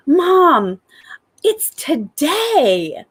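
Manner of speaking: put-on high, drawn-out excited child voice; excited little girl. Three-year-old waking mom at first light, excited. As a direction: imitate a thrilled toddler waking mom. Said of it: "my little girl wakes up every day".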